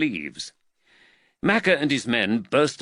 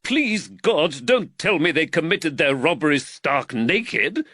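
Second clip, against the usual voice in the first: high-pitched, incredulous exasperation; exasperated telling-off, pitched right up; exasperated magistrate; 7 semitones higher, 4 dB louder than usual. A narrator's reading. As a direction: scold the constable in exasperation. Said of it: "said the exasperated magistrate".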